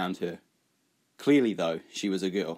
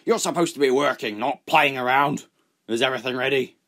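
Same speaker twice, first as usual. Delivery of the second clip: loud, higher scolding yell; 4 semitones higher, 5 dB louder than usual. Acted. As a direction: yell at the boy for slacking off. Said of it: "The smuggler yelled at him".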